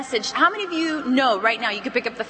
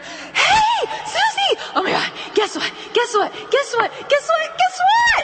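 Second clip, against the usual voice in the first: squealing, very high excited shout; bursting with gossip; 3 dB louder than usual. Shown as a demonstration. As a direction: burst onto the phone with breathless repeated urgency. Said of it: "sound like you have gossip".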